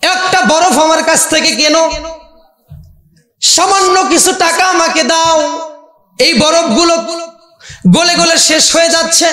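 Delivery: high, sustained, tearful-tune plea; plaintive wailing cry. Acted. Desperate ice seller begging passers-by to buy, plaintive wailing cry. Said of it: "করুণ কান্নার সুরে চিৎকার করছে".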